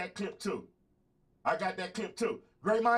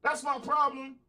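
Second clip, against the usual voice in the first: angry retort; 7 semitones higher than usual. Real, not acted. Yelling back at the president's clip.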